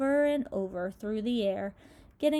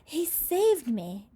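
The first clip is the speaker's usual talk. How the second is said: higher, slow breathless gasp; 6 semitones higher, 4 dB louder, slower than usual. Acted. Rescued centipede gasps to the crew, breathless relief. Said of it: "gasped the centipede".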